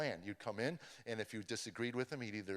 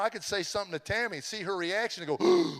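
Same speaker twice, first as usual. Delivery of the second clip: normal talking voice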